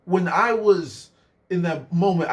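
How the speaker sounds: ordinary delivery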